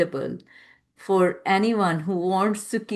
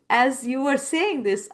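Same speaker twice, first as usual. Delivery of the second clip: ordinary delivery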